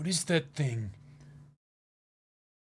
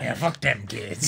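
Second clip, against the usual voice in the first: mock stoner. Joining the stoner bit.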